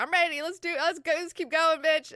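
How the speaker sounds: high, loud, pumped; eager shout. Retold or imitated. Young man urging Jessica to keep going, high and loud.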